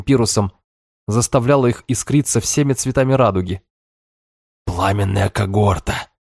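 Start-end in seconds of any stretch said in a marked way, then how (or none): 4.7-6.1 slow awed hiss of Пламенная когорта; admiring hiss. A narrator's reading. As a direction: breathe it out in awe. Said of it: "с восхищением прошипел".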